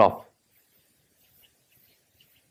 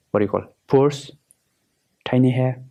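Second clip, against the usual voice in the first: ordinary delivery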